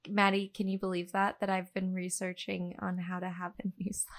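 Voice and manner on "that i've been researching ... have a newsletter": hushed whisper. Real, not acted. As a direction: whisper it like a secret. Confessing a secret.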